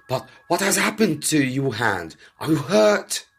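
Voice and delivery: high, anxious questions; rising alarm over the hurt hand; worried. Acted. Servant fretting over his master's injured hand.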